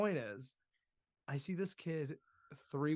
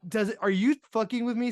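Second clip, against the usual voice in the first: high, loud, rapid disbelief; exasperated and loud at a friend; incredulous; 9 semitones higher, 8 dB louder, faster than usual. Acted. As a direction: blurt it out, can't believe it. Acted disbelief at friend's misspelling.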